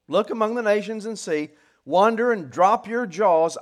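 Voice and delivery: loud, high command driven through WONDER and DROP YOUR JAWS; preacher's ringing emphasis on every word of "drop your jaws"; emphatic imperative. Acted. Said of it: "actually adds to the emphatic emphasis of an imperative".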